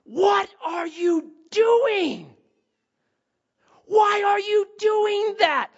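high, slow, loud shrieking yell; retelling a mom screaming at her daughter in the park; mock scream. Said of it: "started to yell, top of her lungs".

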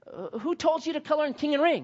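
high, puzzled teacher voice; teacher questioning the kids' coloring, high and puzzled; kindergarten teacher. Retold or imitated. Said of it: "And the teacher said".

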